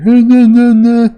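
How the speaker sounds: loud, high-pitched stuttering cackle; goofy villain laugh. Acted. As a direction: cackle like a cartoon brat. Voicing Charlos's laugh from manga.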